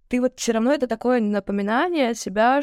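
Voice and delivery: ordinary talk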